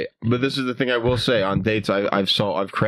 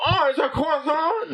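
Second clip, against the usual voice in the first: very high, mincing British mimicry; mock the listener's "croissant" as KWASS-on; exaggerated high-pitched parody; mocking imitation. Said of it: "You're like".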